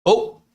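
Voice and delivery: high, loud little "ope!"; startled, clipped-off oops sound; polite Midwest "ope". Retold or imitated. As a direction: pop out a high-pitched apologetic yelp. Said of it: "It means oops".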